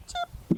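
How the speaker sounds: very high, quick chip; a sudden squeak to break the dog's focus; sharp chip sound. Shown as a demonstration. Trainer showing an interrupting sound, high chip. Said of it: "That sound can be anything".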